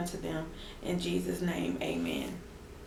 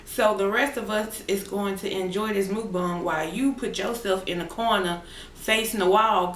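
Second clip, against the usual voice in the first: ordinary talk, nothing marked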